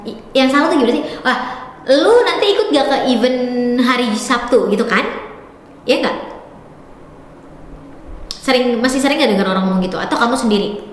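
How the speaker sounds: usual speaking voice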